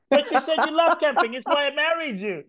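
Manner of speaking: high, laughing protest; betrayed husband. Retold or imitated.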